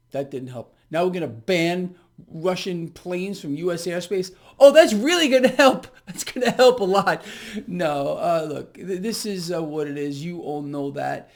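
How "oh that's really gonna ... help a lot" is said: loud, high mocking sarcasm; sarcastic. Real, not acted. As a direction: scoff at it, dripping with sarcasm. Mocking the new Russia sanctions.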